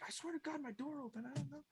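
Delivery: high, hushed protest; bewildered dwarf. Acted. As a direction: overheard boss, soft and high-pitched. Dwarf overheard from next room.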